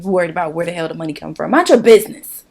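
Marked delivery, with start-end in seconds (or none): 1.5-2.4 loud, higher, irritated command; angry telling-off. Real, not acted. Vlogger telling off mortgage company, loud and angry. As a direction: fire it off at a nosy party.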